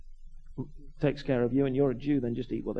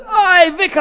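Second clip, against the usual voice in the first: put-on high, loud street-kid greeting; Cockney urchin call; 13 dB louder, slower than usual. Cockney kid finally reappearing to greet the vicar, urchin call. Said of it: "he goes".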